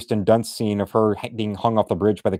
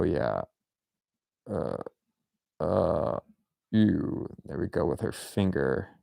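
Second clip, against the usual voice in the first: normal talking voice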